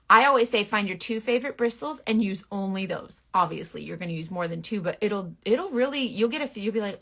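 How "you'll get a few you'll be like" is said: ordinary talk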